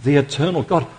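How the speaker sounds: usual speaking voice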